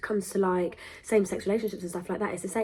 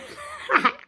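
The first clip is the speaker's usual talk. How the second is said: high giggle. Real, not acted. Laughs at slip.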